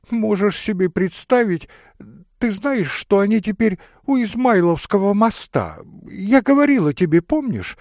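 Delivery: high, loud, agitated lady telling her mishap; voluble excitable lady. Acted. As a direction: pour out a dramatic excuse. (высокий взволнованный дамский голос)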